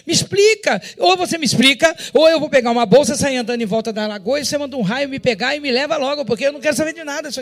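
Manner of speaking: loud, high demand for an explanation; exasperated outburst. Retold or imitated. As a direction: shout at the sky, fed up. Demanding answers from God, outburst.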